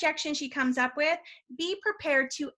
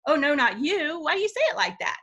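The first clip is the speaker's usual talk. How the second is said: bright, higher, teasing "oh no, not you"; playful mock dismay, pitched up and louder; playful mock surprise; 3 semitones higher, 3 dB louder than usual. Shown as a demonstration. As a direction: tease her gently with a smile. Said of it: "put my personality into it and go".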